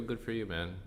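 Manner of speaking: ordinary talk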